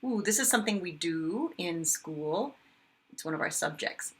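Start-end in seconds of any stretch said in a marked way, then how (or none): none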